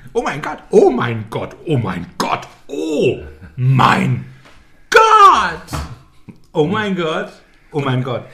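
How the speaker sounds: imitating several squealing reality stars, peaking in a shriek; mock gushing contestants. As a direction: pile on the breathless overexcited chorus. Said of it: "von der Baureihe sind in dieser neuen Staffel von Love Island vier".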